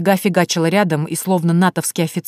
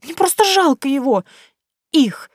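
put-on embarrassed girl; 9 semitones higher, 4 dB louder than usual. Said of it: "Василиса покраснела".

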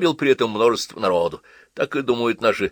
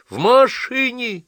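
high, loud, slow horrified gasp; appalled cry; 6 dB louder, slower than usual. A narrator's reading. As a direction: cry out in shock. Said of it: "ещё больше ужаснулась".

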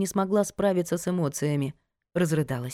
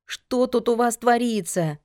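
shouting; 6 semitones higher than usual. A narrator's reading. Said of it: "с криком".

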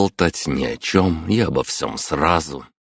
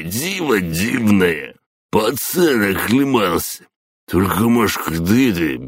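put-on drunk old man; 5 semitones higher than usual. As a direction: ramble like a tipsy grandpa. Пьяный дед продолжает. (пьяный дед, медленно и тягуче)

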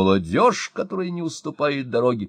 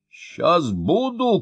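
high, slow shout; 7 semitones higher, much slower than usual. A narrator's reading. Mayor shouting into the phone, high slow call. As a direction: call it out decisively. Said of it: "крикнул Мощин".

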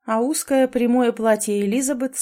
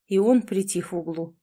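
plain reading voice

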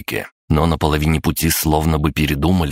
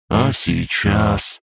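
slow, higher, plaintive little voice; whimpering; 5 semitones higher, much slower than usual. A narrator's reading. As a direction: stretch the question out as if near tears. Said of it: "всхлипнул дракоша". (жалобно, всхлипывая)

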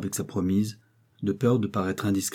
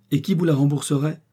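higher, rude challenge; coarsely; 7 semitones higher, 5 dB louder than usual. A narrator's reading. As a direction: throw it at him crudely. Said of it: "avec toute la grossièreté de sa nature".

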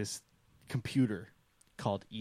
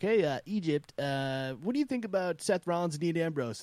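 higher, raised mock host voice; Chris Jericho; 7 semitones higher, 3 dB louder than usual. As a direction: put on an eager interviewer's question. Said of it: "has a conversation with himself and a voice changer".

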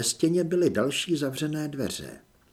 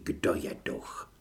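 whisper; 9 semitones lower, 7 dB quieter, slower than usual. Acted. Thought revealing the ghost.

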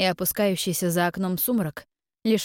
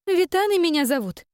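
put-on indignant girl; 10 semitones higher, 3 dB louder than usual. A narrator's reading.

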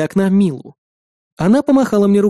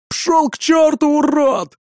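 high furious yell; angry shout; 9 semitones higher, slower than usual. Acted. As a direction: scream it as you charge. Karl charges the raider.

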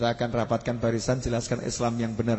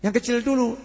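higher elder's quick reply; the elder answers the boy's riddle; old man answering; 10 semitones higher than usual. Retold or imitated. (orang tua menjawab dengan nada tinggi)

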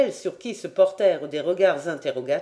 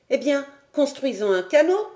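put-on high-pitched, keen boyish outburst; eager exclamation; 8 semitones higher, 4 dB louder than usual. A narrator's reading. Boy Robert proposes a canoe, doubting nothing, eager exclamation. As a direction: pipe up high with a confident idea. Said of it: "s'écria Robert".

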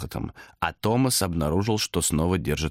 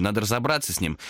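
usual speaking voice

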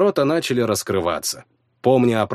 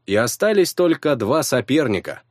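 plain reading voice

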